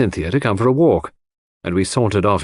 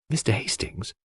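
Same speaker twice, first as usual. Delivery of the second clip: softer, confidential approach; lowered voice; 9 dB quieter than usual. A narrator's reading. Cynthia quietly asking Hastings for a private word, voice dropped. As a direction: drop your voice to get his attention. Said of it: "dropping her voice".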